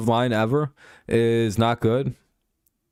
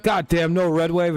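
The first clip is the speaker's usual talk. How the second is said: higher, hammed-up angry grumble; mock angry player; 8 semitones higher than usual. Imitating a mad quarterback over the election, mock anger. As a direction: play a sore loser complaining. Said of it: "He's like".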